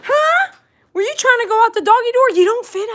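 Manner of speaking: high, loud sing-song pet voice. Real, not acted. Talking to dog who tried doggy door.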